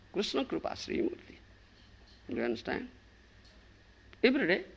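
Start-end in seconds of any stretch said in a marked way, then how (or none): 0.1-1.4 soft whispered repeat; hushed whisper of the holy name; whispered title. Shown as a demonstration. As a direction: breathe the title reverently. Repeated title, high then whispered.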